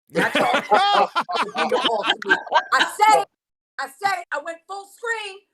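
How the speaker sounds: high voice through giggles; laughing. Real, not acted. Panelist joking with the host, laughing helplessly. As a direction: crack up at your own joke. Guest cracking up at own joke.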